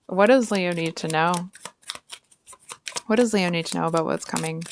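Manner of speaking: ordinary talk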